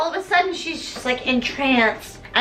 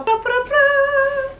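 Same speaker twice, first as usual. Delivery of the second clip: high, drawn-out imitated jingle; monkey music-box noise; 12 semitones higher, much slower than usual. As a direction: mimic the little monkey's tune. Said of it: "we see the little monkey go".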